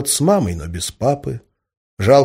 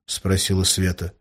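usual speaking voice

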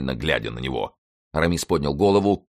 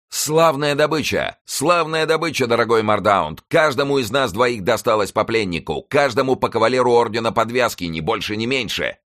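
high, hearty shout to a comrade; calling out; 8 semitones higher, 4 dB louder than usual. A narrator's reading. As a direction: call it out high, as if across a field. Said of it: "обернулся к молодому человеку и крикнул". (громкий высокий выкрик)

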